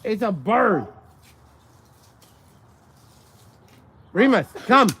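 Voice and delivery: loud, high call to the dog. Real, not acted. Owner calling her dog Remus away from the parrot, loud high call. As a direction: call him back across the yard, bright and high.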